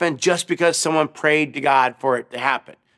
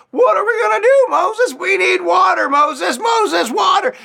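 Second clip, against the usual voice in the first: desperate crowd shout; 4 dB louder than usual. Acted. Thirsty Israelites begging Moses.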